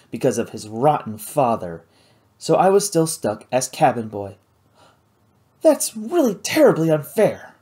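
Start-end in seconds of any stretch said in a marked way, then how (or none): 5.6-7.5 indignant. Acted. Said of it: "she said indignantly".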